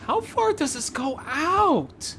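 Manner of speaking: ordinary talk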